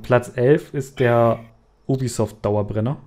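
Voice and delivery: usual speaking voice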